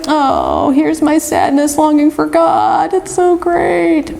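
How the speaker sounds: high, drawn-out self-mocking wail; mock tearful longing. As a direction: imitate yourself crying, slow and very high. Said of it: "I started crying, and I'm like".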